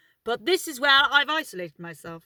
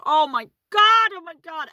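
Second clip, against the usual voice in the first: retold scream; 7 semitones higher, 9 dB louder than usual. Passenger seeing a cat hit by a car, retold scream. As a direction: re-enact a shocked yell. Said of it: "I screamed it".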